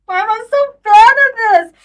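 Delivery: normal talking voice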